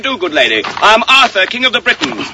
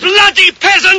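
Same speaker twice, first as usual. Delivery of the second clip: furious higher shout; angry shout; 7 semitones higher than usual. Acted. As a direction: spit out the insult. King shouts at a peasant.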